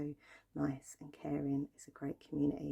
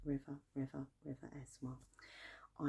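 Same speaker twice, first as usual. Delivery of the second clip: whispering; 5 dB quieter than usual. Acted. ASMR repetition trigger.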